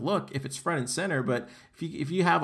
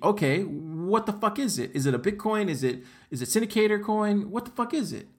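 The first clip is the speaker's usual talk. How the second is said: rising higher each question, incredulous; exasperated demand; 6 semitones higher than usual. Real, not acted. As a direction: keep pressing the unanswered question. Repeated demand about the reward.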